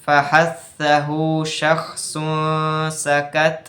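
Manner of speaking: each letter drawn out slowly, ফাহাসাহু শাখসুন সাকাত said as a chant; slow recital of ফাহাসাহু শাখসুন সাকাত, syllable by syllable; slow recited letter mnemonic. Shown as a demonstration. Teacher reciting the hams letters to students, slowly. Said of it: "তো হামসের হরফ দশটি ছিল".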